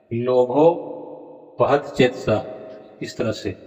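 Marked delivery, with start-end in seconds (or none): 0.1-2.4 "लोभोपहत चेतसा" drawn out very slowly, fused as lobopahat; slow mistaken reading. Shown as a demonstration. Teacher slowly repeating the students' wrong recitation, very slow. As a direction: spell out "लोभोपहत" slowly as one fused word. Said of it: "ऐसा उच्चारण करते हैं".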